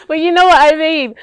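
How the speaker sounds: talking through loud laughter; laughing loudly. Real, not acted. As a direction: still cracking up. Still laughing after failed Pidgin.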